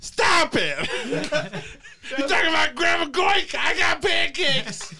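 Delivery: high, laughing granny shout; Grandma Goik. Acted. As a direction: try the shrieky old granny, cracking up. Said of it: "You try it".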